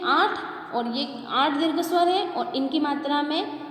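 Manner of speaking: normal talking voice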